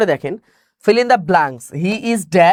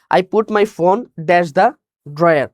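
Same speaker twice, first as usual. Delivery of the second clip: slow dictation; much slower than usual. Shown as a demonstration. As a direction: read out very slowly, word by word. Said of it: "reading the question again". (ধীরে ধীরে প্রশ্ন পড়া)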